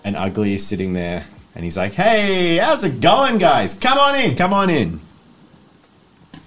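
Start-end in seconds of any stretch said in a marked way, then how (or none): 1.9-4.9 loud, high, cheery welcome; jovial crime boss. Acted. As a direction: throw the door open for the crew. GM voices Ugly welcoming them.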